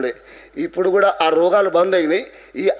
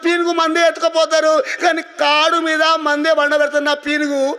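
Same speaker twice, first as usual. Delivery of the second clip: acted weeping; 9 semitones higher than usual. Mother fearing her son's hand will burn, weeping. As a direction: wail it out high, stretching the phrase ends. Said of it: "ఏడ్చిందట".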